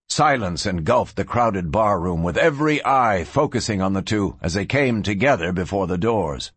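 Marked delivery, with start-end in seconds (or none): none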